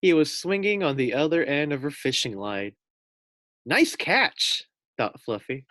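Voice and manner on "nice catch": much higher, louder wry quip; wry pig. A narrator's reading. Pig dangling on the fishing line, high wry quip. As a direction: toss it off wryly.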